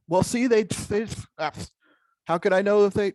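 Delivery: high, loud, stammering confusion; flustered participant. Retold or imitated. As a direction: stumble through the worry, lost for words. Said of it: "acting out a flustered listener".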